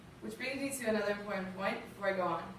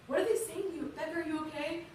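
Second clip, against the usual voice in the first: higher-pitched imitation of a worried mom; scared mother; 8 semitones higher than usual. Mother on the phone to her daughter in the army, scared. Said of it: "She was so scared for me".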